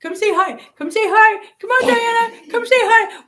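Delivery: high, loud singsong coaxing; excited high-pitched beckoning; calling someone over. Real, not acted. Calling friend over to the stream.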